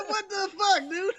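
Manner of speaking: high, loud incredulous yelp; hammed-up shriek of bafflement; shocked outburst. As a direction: burst out in comic disbelief. Re-enacting own shocked reaction, laughing.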